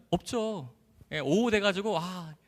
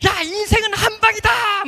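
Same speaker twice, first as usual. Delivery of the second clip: high, loud, drawn-out yell; exultant full-voiced cry; triumphant shout; 8 dB louder, slower than usual. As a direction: holler it out to the whole neighbourhood. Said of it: "창문 열고 소리쳤어요".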